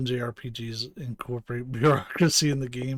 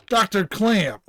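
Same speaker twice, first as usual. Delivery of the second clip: raised-voice call for Doctor Clamp; 5 semitones higher, 4 dB louder, slower than usual. Acted. Officer calling for the absent doctor, calling out. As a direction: call out to someone out of sight.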